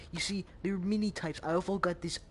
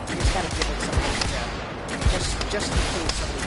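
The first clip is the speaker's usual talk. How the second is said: loud; 9 dB louder, faster than usual. Real, not acted. Loud rambling over music.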